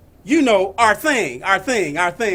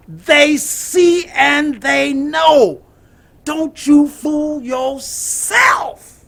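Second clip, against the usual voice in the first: preaching; 2 semitones higher, 4 dB louder, slower than usual. Acted. Preacher building to a climax.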